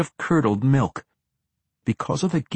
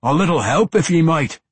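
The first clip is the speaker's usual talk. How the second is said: loud, high dwarf complaint; gruff dwarf; 7 semitones higher, 6 dB louder than usual. A narrator's reading. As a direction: bellow it like an irked dwarf. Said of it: "he said".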